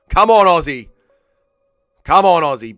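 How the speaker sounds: loud fan's yell at a fighter; real, forceful cheer; shouted urging. Real, not acted. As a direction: shout him on from the stands.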